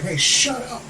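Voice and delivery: high, held-back mock yell; half-hearted shout at noisy neighbors. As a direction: call out in a high voice without real volume. Voices the dude he never is.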